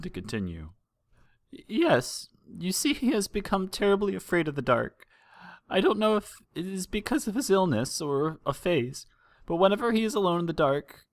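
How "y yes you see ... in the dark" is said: high, trembling voice of a frightened mare; a worried mother holding back tears; tearful mother. Acted. Frightened mother telling the princesses of her missing son, high and tearful. Mother explains son's fear.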